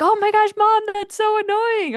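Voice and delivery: high, loud mock kid protest; exasperated kids. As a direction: complain to mom like an irritated child. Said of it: "They're like".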